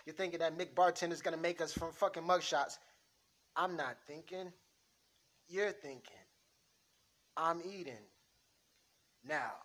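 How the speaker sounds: lightly voiced mobster arguing over a hit; a hint of a tough New York wiseguy; mob boss scene. Mob boss to rival over a killing, lightly tough.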